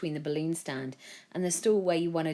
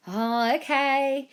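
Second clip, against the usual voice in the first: higher, slow reluctant okay; reluctant boy; 9 semitones higher, 6 dB louder, much slower than usual. A narrator's reading. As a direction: give in hesitantly. Joe reluctantly agrees.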